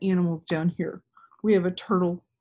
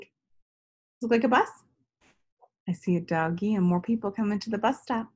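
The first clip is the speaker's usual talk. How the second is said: ordinary delivery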